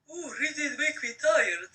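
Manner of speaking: ordinary delivery, nothing marked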